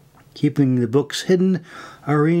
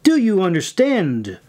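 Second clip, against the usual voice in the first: higher and firmer, DO stressed; repeated louder; 6 semitones higher than usual. A narrator's reading. Irene repeats her demand to the recruit, louder. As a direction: demand an answer, sterner now. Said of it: "Irene repeated louder this time".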